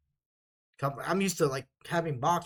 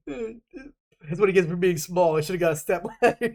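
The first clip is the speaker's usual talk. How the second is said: laughing; 3 semitones higher, 4 dB louder than usual. Real, not acted. Teasing the short sergeant, laughing. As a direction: talk through real laughter. Laughing at his own joke.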